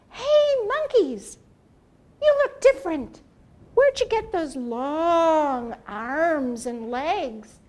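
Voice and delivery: high, loud call up to the trees; calling Turtle. Acted. Turtle calling up to the monkeys, high and loud.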